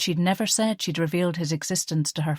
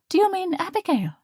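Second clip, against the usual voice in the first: higher, incredulous query; can't believe he means her friend; puzzled; 13 semitones higher than usual. Acted. Liesl checking whom Kurt mocked, incredulous.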